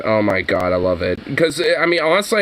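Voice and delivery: normal talking voice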